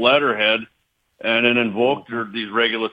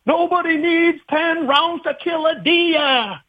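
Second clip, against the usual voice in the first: high, slow New York accent impression; slower than usual. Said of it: "That was my New York accent".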